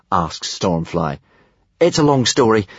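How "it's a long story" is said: higher, mildly urgent brush-off; hurried. A narrator's reading. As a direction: wave it away with a touch of haste. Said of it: "said Hiccup hurriedly".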